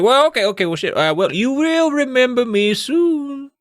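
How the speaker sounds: high, hammed-up old man voice; gentle old man. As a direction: play a kindly old man, stretching the last word. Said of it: "he's a gentle guy, gentle old man".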